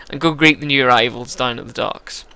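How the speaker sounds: usual speaking voice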